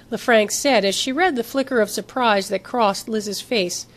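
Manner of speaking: ordinary delivery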